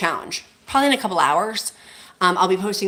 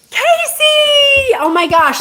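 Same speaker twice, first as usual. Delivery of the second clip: excited squeal; 9 dB louder, much slower than usual. Real, not acted. Thrilled by a viewer's donation.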